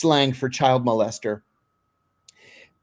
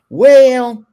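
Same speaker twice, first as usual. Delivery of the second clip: loud, drawn-out North Carolina hillbilly "well"; put-on Appalachian drawl from North Carolina; North Carolina mountain accent; 3 semitones higher, 6 dB louder, slower than usual. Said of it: "he was from the mountains of North Carolina".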